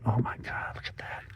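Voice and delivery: awed hushed whisper. Real, not acted. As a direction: breathe it out in awe. Awed hushed admiration of figure.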